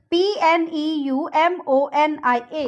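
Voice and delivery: normal talking voice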